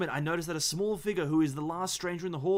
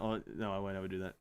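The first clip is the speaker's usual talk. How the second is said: softer, fast promise; hurried quiet apology; 6 semitones lower, 6 dB quieter, faster than usual. Real, not acted.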